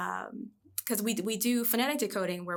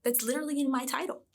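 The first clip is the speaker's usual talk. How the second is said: upbeat encouragement; 5 semitones higher than usual. Retold or imitated. Teacher reassuring crying student.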